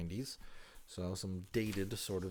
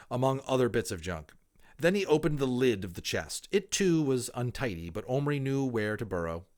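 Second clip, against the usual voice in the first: put-on clear, lively reading of a children's book; expressive storybook narration; story narration; 4 semitones higher, 8 dB louder than usual. A narrator's reading. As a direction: read it aloud like a storyteller to listeners. Reads a children's novel aloud.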